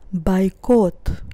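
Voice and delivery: "Бойкот" with unstressed first O said as A. Shown as a demonstration. Teacher shows learners the Russian O rule, "Бойкот" said bai-KOT. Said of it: "That's why I pronounce it as A".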